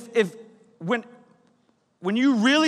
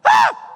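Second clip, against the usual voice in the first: high shriek of fright; kids' panicked shriek; 7 dB louder than usual. Retold or imitated. Children screaming when someone knocks. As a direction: scream and run to hide. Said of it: "it's like".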